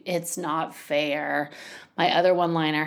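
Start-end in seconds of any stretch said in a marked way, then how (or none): 0.0-1.5 put-on drawn-out, quiet whine of a sulking child; slow, soft childish complaint; whiny child. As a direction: mimic a kid moping it's not fair. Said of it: "your child who's screaming at you".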